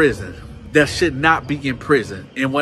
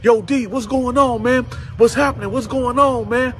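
high, raised calling voice; imitated yelling; 8 semitones higher than usual. Inmates asking down the tier, high mock yelling. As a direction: mimic neighbours yelling along a corridor. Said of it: "people was yelling down the tier".

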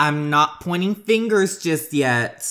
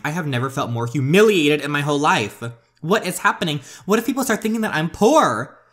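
higher, louder outraged wail; enraged; 4 semitones higher, 4 dB louder than usual. Acted. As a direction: rage at the humiliation. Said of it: "she is enraged".

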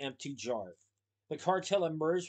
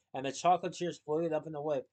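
ordinary delivery

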